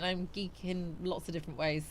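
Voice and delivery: usual speaking voice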